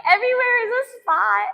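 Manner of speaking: high, strained whimper; pained whine. Retold or imitated. Telling viewers every spot hurts, in a high strained whine. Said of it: "I'm like".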